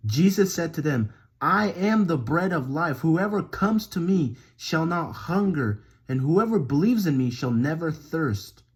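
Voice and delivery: emphatic verse reading with lively rises; scripture reading. Acted. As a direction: read it out with momentum and punch.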